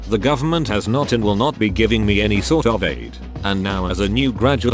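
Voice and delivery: plain reading voice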